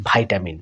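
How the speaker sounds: "ভিটামিন" said as vai-ta-min; American pronunciation. Shown as a demonstration. Teacher demonstrates American style, first syllable said vai. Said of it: "style উচ্চারিত হয়ে থাকে".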